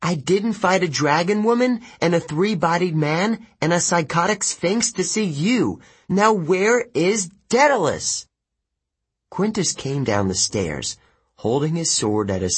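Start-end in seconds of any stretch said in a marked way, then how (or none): 0.0-8.3 put-on high, heated, indignant girl; fed up, pitched well up throughout; growled demand. A narrator's reading. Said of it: "she growled".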